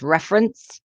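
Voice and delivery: "reference" with the first syllable stressed. Shown as a demonstration. Teacher showing similar words apart, "reference" stressed on the first syllable. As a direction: say "reference" as REF-erence, weight up front. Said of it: "with the stress on the first syllable".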